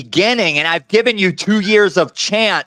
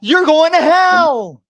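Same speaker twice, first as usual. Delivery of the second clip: higher, slow, damning pronouncement; condemning the host, slow and raised; angry condemnation; 7 semitones higher, slower than usual. Real, not acted. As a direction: drag it out, raised and furious. Heated quarrel on live call.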